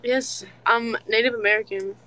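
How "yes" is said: usual speaking voice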